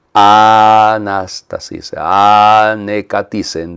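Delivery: very slow, first vowels stretched long; slow, sustained recitation of Greek words; drawn-out Greek quote. Shown as a demonstration. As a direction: hold the opening A, then release.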